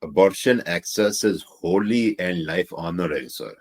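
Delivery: put-on slow, loud Pakistani accent. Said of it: "Let [name] read them in the Pakistani accent".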